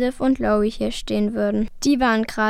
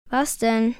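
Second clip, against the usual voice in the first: ordinary delivery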